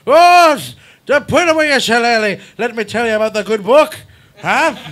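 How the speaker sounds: loud, high-pitched mock Irish preacher; old Irish preacher. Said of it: "an old Protestant Irish fucking preacher".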